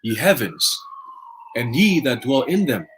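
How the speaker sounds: slow, solemn Bible reading; scripture reading. Acted. Reading Revelation to listeners, slow solemn scripture voice. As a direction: intone the verse slowly like a preacher.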